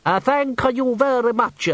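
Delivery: put-on high, slow, dripping Italian-style accent; hammed-up foreign accent, drawn out; thick foreign accent. A narrator's reading. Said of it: "his voice dripping with accent".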